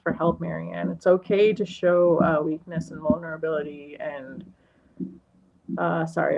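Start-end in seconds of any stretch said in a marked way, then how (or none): none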